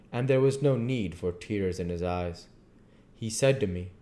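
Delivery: usual speaking voice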